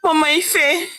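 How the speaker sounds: plead, close to tears; jailed husband near tears begging his wife; almost crying. Acted. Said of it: "almost crying".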